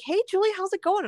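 high, fast, chirpy hello; cheery greeter. Retold or imitated. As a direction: play a breezy coworker saying hi. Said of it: "You could have been like".

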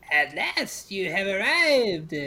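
loud, high-swooping crone, words drawn out; crackly old crone. Acted. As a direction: croak it out slowly like a triumphant witch. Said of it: "her voice crackles like dry weeds".

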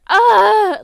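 loud, high drawn-out "ah"; sudden loud wail of overwhelm; overwhelmed groan. Real, not acted. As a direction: cry out in exasperation. Overwhelmed about last day of school.

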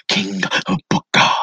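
imitating a British king's accent; mock British royal accent, words set apart; lightly put-on British king; King Booker. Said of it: "He had an accent like he was a king".

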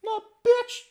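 very high, loud squeal; mock squeaky retort. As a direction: squeal it like a cartoon diva.

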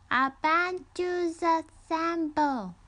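put-on high, slow baby-talk squeal; loud, drawn-out toddler voice; squealing child. A narrator's reading. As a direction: mangle "Avengers Assemble" like a baby learning to talk. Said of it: "the child squealed".

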